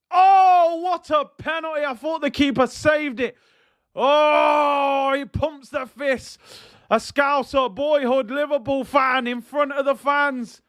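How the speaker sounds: real goal-celebration roar, pitch way up; excited shout. Real, not acted. Penalty scored, commentator erupts.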